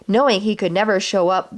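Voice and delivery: ordinary delivery, nothing marked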